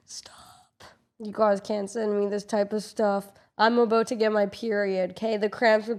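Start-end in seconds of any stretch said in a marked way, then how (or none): 0.1-0.9 very soft, voiceless whisper; overwhelmed whisper. Real, not acted. Streamer overwhelmed by a viewer's message, whispering. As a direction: whisper it, too moved to speak up.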